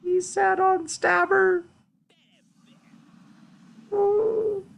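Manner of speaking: very high, drawn-out falsetto; squeaky mock distress. Lamenting that the peasant sat on Stabber, squeaky mock distress. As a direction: squeal it slowly in pitying falsetto.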